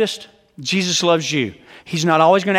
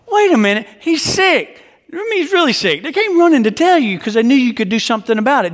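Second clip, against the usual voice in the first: worked-up protest; 6 semitones higher than usual. Acted. Protesting Jesus's delay.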